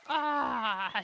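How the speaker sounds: usual speaking voice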